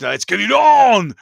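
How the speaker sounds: slow, loud announcer call, pitched high; loud ring announcer roar; Celebrity Deathmatch catchphrase. Retold or imitated. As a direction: bellow it like a ring announcer opening the fight. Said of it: "Celebrity Deathmatch".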